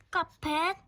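normal talking voice

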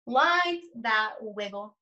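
put-on slow, sing-song read-aloud to young children; lingering on each rhyme, long pauses between pages; story read aloud. Reads picture book aloud slowly.

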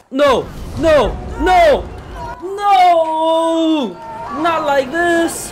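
high, loud wails of no, slowing at the end; real anguish as his character goes down; despairing shouts. Real, not acted. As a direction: gamer yelling in despair. Character dying in game.

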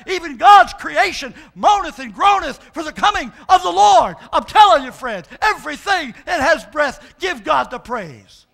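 loud, high shouted sermon climax; full-force preaching. Acted. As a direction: preach it to the rafters.

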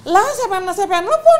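indignant shout. Retold or imitated. Wife shouting back at her accusing husband. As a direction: yell back in disbelief.

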